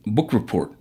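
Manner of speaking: plain reading voice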